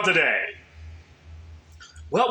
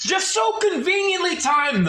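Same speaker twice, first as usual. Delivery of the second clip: shouting; 11 semitones higher than usual. Acted. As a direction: burst out in disbelief. Sudden outburst.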